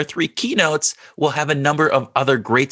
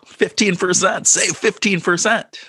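higher, punchy ad pitch voice; insurance commercial; 6 semitones higher than usual. Acted. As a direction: hype the discount like a commercial. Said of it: "sounding like an insurance commercial".

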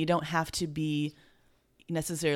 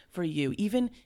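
ordinary talk

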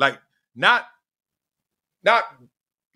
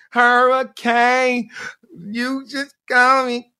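high, slow drawn-out hype call; drawn-out nickname call, high and slow; mock hype call; 9 semitones higher, much slower than usual. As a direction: stretch it out like an announcer. Calling out his nickname.

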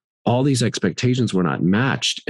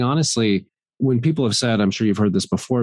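ordinary delivery

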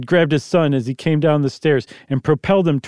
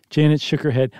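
ordinary delivery

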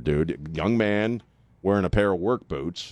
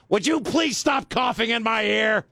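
put-on shrill high shout of an old lady; old crone yelling; 13 semitones higher than usual. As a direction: screech it like a cranky old woman. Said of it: "this old crone just appears over my seat".

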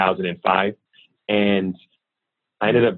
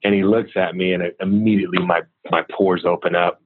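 ordinary talk

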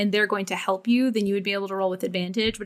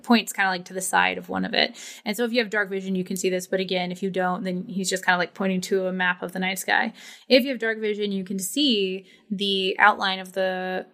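ordinary talk, nothing marked

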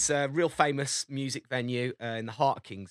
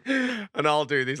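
ordinary delivery

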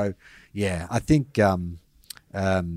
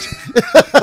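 high burst of laughter; 9 dB louder than usual. Real, not acted.

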